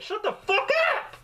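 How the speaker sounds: answering people who laugh at him; snapping back. Retold or imitated. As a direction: tell the mockers off, half laughing. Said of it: "I'd be like".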